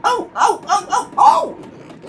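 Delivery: very high, loud squeals; screaming trucks. Acted. Trucks screaming as they bump together. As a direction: shriek like startled silly trucks. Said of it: "screamed the trucks".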